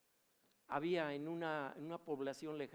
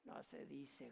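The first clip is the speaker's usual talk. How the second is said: very slow, softer, strange demonic voice; a demon pedantically correcting, slow; quiet demon voice; 9 dB quieter, slower than usual. Retold or imitated.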